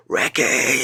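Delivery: high, carrying mock bellow; big loud voice. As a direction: imitate a coach calling across the pitches. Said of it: "you know, big loud voice".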